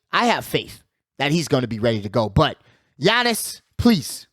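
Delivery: usual speaking voice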